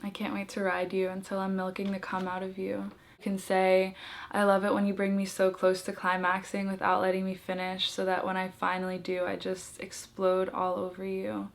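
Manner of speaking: soft, hushed and shy, like a dare. Shown as a demonstration. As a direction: murmur it shyly but boldly. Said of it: "look back up kind of shyly and be like".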